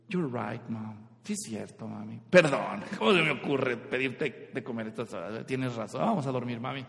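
put-on very soft, low, meek baby voice; baby agreeing. Baby agreeing with mom to sleep, meek and very soft. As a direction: give in sweetly and quietly. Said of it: "Entonces el baby dice".

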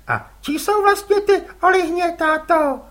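put-on a boy asking innocently; curious boy. Les asks his father whose squid they are, boyish.

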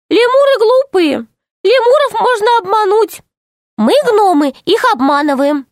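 high, earnest little gnome; tiny gnome voice. Acted. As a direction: explain it like a small nervous gnome. Gnome explains. (тоненький голос гнома)

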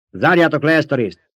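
high, loud command; shouted order. A narrator's reading. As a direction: call out the order to seal up. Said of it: "kiáltotta Paul".

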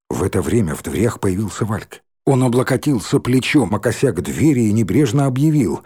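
usual speaking voice

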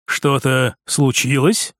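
low, slow male character voice; reserved brother's question; lightly voiced prince. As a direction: ask it low and unhurried.

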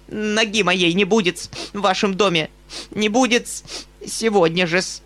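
high, indignant scolding; offended companion. A narrator's reading. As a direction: huff out a wounded protest.

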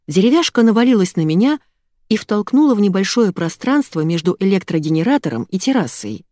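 ordinary delivery

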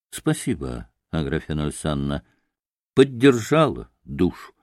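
low, subdued thanks with a touch of tremble; trembling voice. A narrator's reading. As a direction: thank her softly, quietly moved. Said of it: "дрожащим голосом отозвался Митя".

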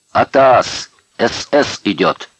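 loud, high alarm shout; bellowing. A narrator's reading. Prisoner warning the barracks that SS are coming, bellowing. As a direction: take a big breath and yell a warning. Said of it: "набрал побольше воздуха и гаркнул".

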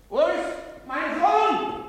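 high, loud, slow wail; anguished cry. Acted. Father cries out desperately for his lost son Andri.

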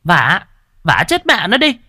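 high, loud, spat-out order to beat him; harsh furious command. Acted. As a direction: snarl the order at the servants. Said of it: "bà Phụng gằn giọng".

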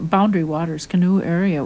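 normal talking voice

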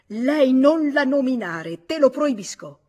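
slow, high, very loud prohibition; angry command. A narrator's reading. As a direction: forbid it furiously, at full voice. Grabs and shakes her, forbidding. (ordine urlato, lento e furioso)

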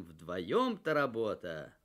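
ordinary delivery

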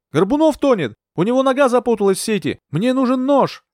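high, alarmed call to shore; urgent shout. A narrator's reading. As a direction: cry out for help from the water. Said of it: "крикнула я".

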